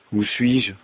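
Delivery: ordinary delivery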